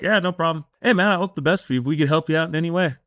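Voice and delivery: high, fast, breezy reassurance; a clinic staffer cheerfully waving him back. Retold or imitated. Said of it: "it was just like".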